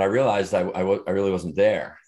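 ordinary talk

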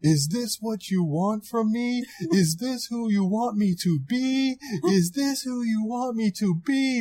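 high-pitched, drawn-out yell held back in volume; restrained mock yell. Film fan acting out Magneto's favorite line, restrained mock yell. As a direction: yell to the heavens without getting loud. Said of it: "I'm not gonna be too loud, but he yells".

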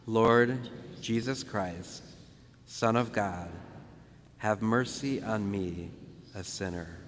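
slow, softened prayer with pauses between phrases; measured call-and-repeat prayer, fading low at the end. Shown as a demonstration. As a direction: lead a group through it unhurriedly. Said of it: "We'll say it very slow".

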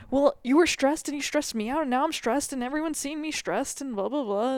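high, loud mock-whine of stress; mocking whiny complaint. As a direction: parody the stressed partner, hammed-up.

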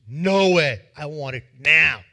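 loud, hard demand; the creditor refuses and demands payment loudly; angry refusal. Acted. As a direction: refuse flatly and insist. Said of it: "And this guy says".

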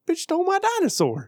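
put-on high, whiny little-kid voice. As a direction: play the kid whose toy was stolen. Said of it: "it was like".

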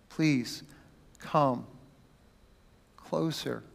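soft, low, gentle invitation; tender plea. Acted. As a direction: say it like calming a frightened child. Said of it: "extremely gentle and tender".

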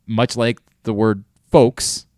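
ordinary talk, nothing marked

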